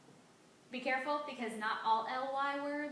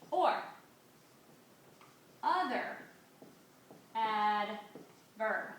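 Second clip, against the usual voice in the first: word by word, loud, with long pauses; slow dictation; 4 dB louder, much slower than usual. Shown as a demonstration. Teacher dictating a definition for students to copy, slow dictation. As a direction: dictate slowly, leaving time to write each word. Dictating words as they write.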